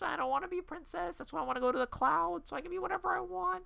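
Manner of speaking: very high, whiny put-on imitation; mock a complaining cartoon princess; squeaky, exaggerated parody complaint. Mocking Jailbreak's feminist complaint.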